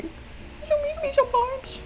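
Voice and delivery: tiny, extremely high mock complaint; squeaky mouse voice. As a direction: squeak it softly like a hurt mouse. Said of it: "I sound a little bit like a mouse".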